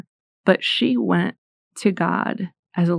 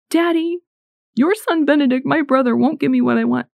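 put-on high childlike plea to daddy; little girl pleading; 8 semitones higher than usual. Scholastica as a little one asking her daddy, high childlike plea. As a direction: whine it up high like a small child. Saint asks God as child.

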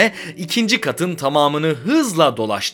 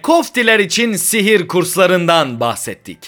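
higher, louder, lively sign-off; podcast outro announcer; 5 semitones higher, 5 dB louder than usual. Real, not acted. Host thanking Potter fans for listening, lively announcer delivery.

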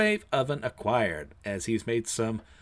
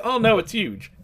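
dismayed surprise; 10 semitones higher, 5 dB louder than usual. Acted. Everyone dismayed at huge backpack.

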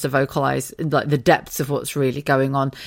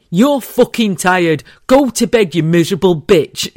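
louder, mock stern telling off; husband's blunt order; 2 semitones higher, 7 dB louder than usual. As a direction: bark it like a fed-up husband. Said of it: "he went".

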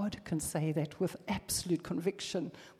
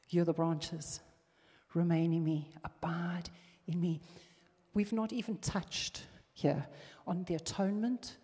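ordinary delivery

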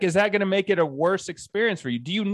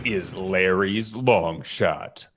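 low, slow announcer voice; segment announcer; 9 semitones lower, much slower than usual. Acted. Host introducing Larry's betting segment, low slow announcer voice. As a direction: draw it out deep like a show jingle.

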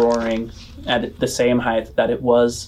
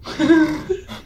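high mocking boy laugh.

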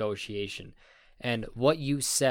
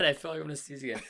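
laughing; 4 semitones higher, much faster than usual. Real, not acted. Laughing while about to sneeze.

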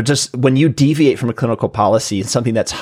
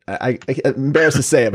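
ordinary delivery

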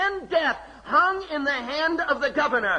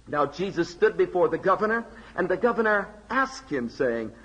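scripture reading; 6 semitones lower than usual. Acted. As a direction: let the governor's question hang. Preacher reads verse eleven.

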